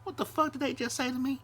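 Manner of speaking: squeaky high indignant voice; shrill up into a mock outraged squeak; outraged disbelief. Mocking how we react to offhand remarks, squeaky high outrage. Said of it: "I be like that".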